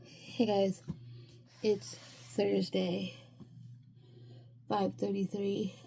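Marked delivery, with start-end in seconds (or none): none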